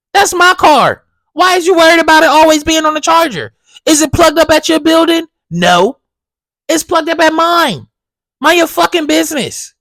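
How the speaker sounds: loud, high, heated rant at the neighbor; ranting at the nosy neighbor, loud and high; angry rant. Retold or imitated. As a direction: tell her off, fed up and loud.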